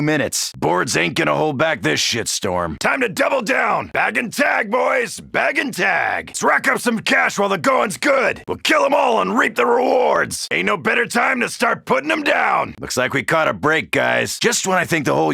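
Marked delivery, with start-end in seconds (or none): 2.8-12.7 gung-ho combat shout; battle shout. Acted. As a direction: fire up the squad with a yell. Battle cries to squadmates.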